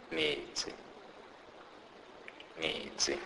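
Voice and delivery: "metsi" softly, TS as in "mats"; example word demo. Shown as a demonstration. Teacher gives a T S example, soft careful "metsi". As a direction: sound out "metsi" softly, long E, crisp TS. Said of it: "pronounced like the T S in the word".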